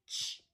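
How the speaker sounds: "Ch" as a quick burst of air; short ch sound. Shown as a demonstration. Teacher contrasting digraphs, short "Ch" burst. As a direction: release "Ch" short, without a vowel. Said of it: "I hear a short air coming out".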